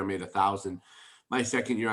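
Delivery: ordinary talk, nothing marked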